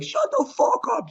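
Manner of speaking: very high, squawked mock rage; strained mock scream of anger; freaking-out outburst. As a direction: imitate a leader losing it, pitch shooting way up. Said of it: "So he's freaking out".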